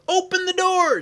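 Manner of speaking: very high, loud yell; urgent yell. Acted. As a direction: yell to be let in at once. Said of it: "she yelled".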